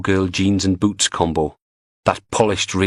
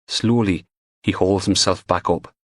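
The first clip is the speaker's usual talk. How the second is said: ordinary delivery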